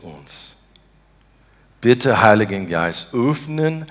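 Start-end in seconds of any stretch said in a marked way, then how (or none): none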